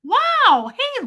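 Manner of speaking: sudden high delighted wow; surprised. Real, not acted. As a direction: caught off guard, thrilled. Surprised a famous guest joined.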